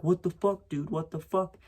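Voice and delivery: high-pitched mock outrage; retold angry outburst. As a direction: act out an indignant, hurt friend. Retold friend's outburst after falling.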